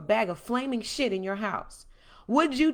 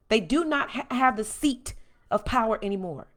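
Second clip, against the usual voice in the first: ordinary delivery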